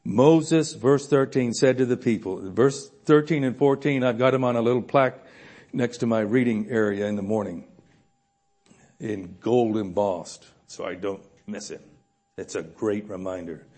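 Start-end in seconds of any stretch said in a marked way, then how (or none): none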